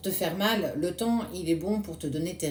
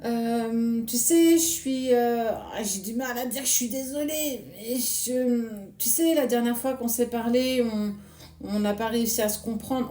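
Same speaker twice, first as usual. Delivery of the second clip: higher, halting, stumbling over every word; a lightly voiced embarrassed ex, all ums and pauses; hesitant awkward apology; 4 semitones higher than usual. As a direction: play him fumbling for a sorry he can't say. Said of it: "un petit peu comme ça".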